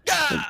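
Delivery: loud high yell; mock rage yell. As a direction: yell like a furious driver. Mock road rage shout.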